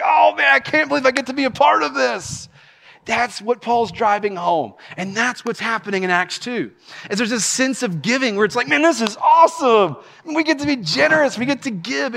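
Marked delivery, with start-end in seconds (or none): 0.0-2.5 high, thrilled exclamation; acted-out burst of joy; overjoyed giver. 8.7-12.1 high-pitched, eager enthusiasm; thrilled to get to join in; acted-out excitement; delighted giver.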